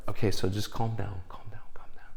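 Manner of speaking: soft whisper, calm down. Retold or imitated. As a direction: hush him gently. Whispered calming.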